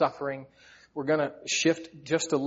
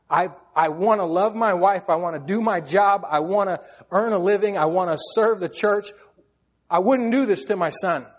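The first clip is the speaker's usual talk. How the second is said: higher, louder desperate plea; crying out to God; 6 semitones higher, 7 dB louder than usual. Retold or imitated. A man in pain crying out to God for help. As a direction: pour it out like a desperate prayer. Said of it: "I was crying out to God".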